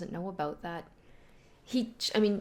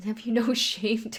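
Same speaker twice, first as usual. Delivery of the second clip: laughing through the line, higher and louder; mock scolding broken by a laugh; 4 semitones higher, 6 dB louder than usual. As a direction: giggle while teasing. Reading a flustered line with laugh.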